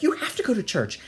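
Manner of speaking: high-pitched retold scolding; mock insistent mother. Mother ordering her son to church, mock insistent. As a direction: imitate an exasperated mother insisting.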